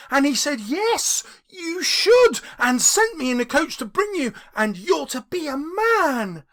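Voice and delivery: put-on high, loud and bubbling little girl; gleeful child. Little Fan gleefully telling her brother he's going home. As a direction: burst in full of joy for her brother. Fan continues joyful speech.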